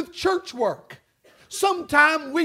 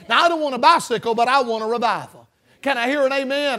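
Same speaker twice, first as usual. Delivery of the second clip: ordinary talk, nothing marked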